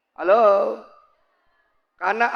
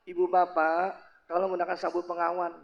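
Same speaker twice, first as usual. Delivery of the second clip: soft, low cabin request; polite flight attendant; 5 semitones lower, 8 dB quieter than usual. Acted. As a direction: gently ask passengers, very courteous. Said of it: "pramugari yang perawan ngomongin santun". (pramugari santun dan lembut)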